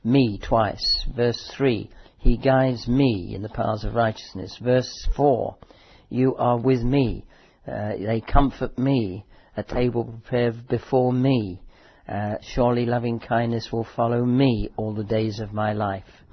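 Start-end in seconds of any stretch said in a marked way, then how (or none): 0.0-0.4 "me" loud and weighty; stress on me. Shown as a demonstration. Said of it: "Look, verse two, "me" twice". 2.2-16.2 loud, emphatic sermon voice; preaching. Acted. Lists the psalm's "me"s.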